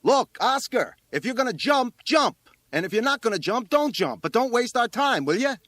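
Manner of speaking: high, loud impatient yelling; exasperated fireman. A narrator's reading. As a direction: lay down an ultimatum at a shout. Fireman loses patience, shouting up.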